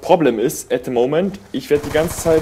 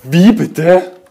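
outraged disbelief; 6 semitones higher, 8 dB louder than usual. Real, not acted. Gamer outraged at a kill.

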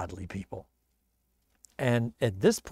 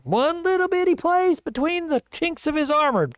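very high, small-sized voice; tiny squeezed voice; 12 semitones higher than usual. Retold or imitated. Preacher describing an arrow's tiny target, high-pitched. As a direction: pitch it way up for a teeny gap.

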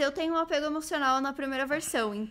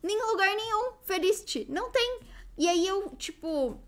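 high-pitched exasperated complaint; frustrated fan, voice climbing; 9 semitones higher than usual. Real, not acted. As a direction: lament a fruitless search, pitched high. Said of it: "eu sou muito frustrada".

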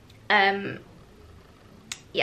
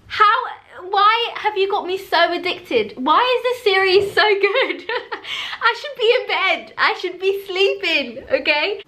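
giddy addicted whine; 6 semitones higher than usual. Real, not acted. Addicted giddy complaint to author.

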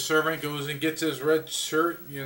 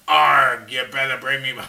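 laughing pirate voice; 6 semitones lower, 4 dB louder than usual. Real, not acted. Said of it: "I can't even say it again, it's so funny".

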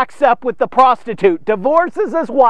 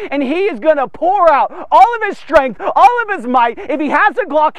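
fiery pulpit warning, raised voice; full preaching; 5 semitones higher than usual. Acted. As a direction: preach it hard, building on each line. Preaching builds on vengeance.

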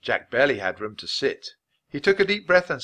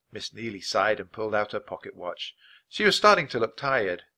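usual speaking voice

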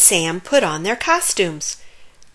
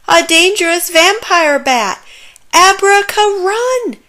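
alarmed shout; 10 semitones higher, 8 dB louder than usual. A narrator's reading. Zoe spots vampire bat, flees.

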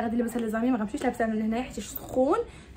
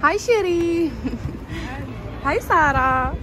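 called-out greeting; 2 semitones higher, much slower than usual. Real, not acted. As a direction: call it out to a cousin. Calling greetings to cousins. (تحية بالعياط)

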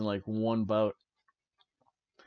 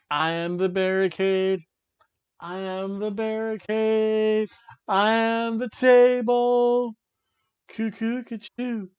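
high, slow, unhinged "I am the barricade"; crazed barricade voice; 8 semitones higher, 4 dB louder, slower than usual. Pretend. Podcaster voicing a deranged wrestler, high and slow. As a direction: play a wrestler gone mad, chanting.

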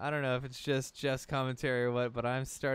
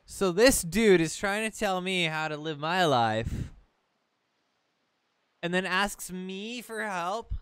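loud, higher-pitched indignation; incredulous complaint; 6 semitones higher, 7 dB louder, slower than usual. Real, not acted. Player complains about the boat asking for help, incredulous. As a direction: protest loudly, then stretch out the disbelief. Mock outrage at the boat's demand.

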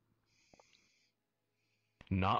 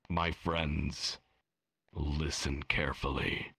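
game master voice; 2 semitones lower than usual. A narrator's reading. GM addresses players.

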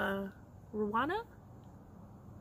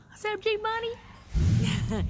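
high, slow, loud hello; calling out greeting; 10 semitones higher, 8 dB louder, much slower than usual. Real, not acted. Greeting her friend Jilda on camera, high loud call.

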